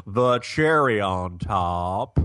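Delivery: slow, hammed-up southern drawl; phony southern drawl with TOP dragged long; fake southern accent. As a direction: stretch it out in a mock southern accent. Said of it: "top also is a fourteen syllable word".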